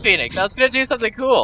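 high, loud, fast exasperated cry; frustrated outburst. Real, not acted. Ship crashing before his stunt, loud frustrated cry. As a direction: complain loudly in frustration.

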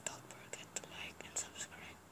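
soft unvoiced whisper. Real, not acted. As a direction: whisper it like a secret. Whispered outro.

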